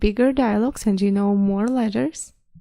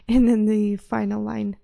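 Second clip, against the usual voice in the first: usual speaking voice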